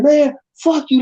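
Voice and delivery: high, sharp mock retort; brother's angry retort. Brother telling off the drunk guy, with a high angry retort. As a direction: spit it back at the guy. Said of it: "My brother was like".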